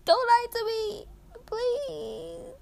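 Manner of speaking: very high fake-crying whine; hammed-up tearful squeak, dragging out the plea; mock pleading. Teen jokingly begs listeners not to lie, mock pleading. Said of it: "I'm being sarcastic".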